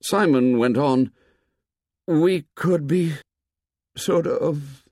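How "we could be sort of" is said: put-on slow, faltering, higher-pitched boy; halting, unfinished attempt; inarticulate. A narrator's reading. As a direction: struggle for the words and give up. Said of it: "Simon became inarticulate in his effort".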